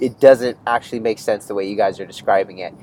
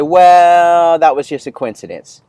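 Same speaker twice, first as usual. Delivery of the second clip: dismissive excuse; 4 semitones higher, 7 dB louder, slower than usual. Retold or imitated. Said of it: "I could say".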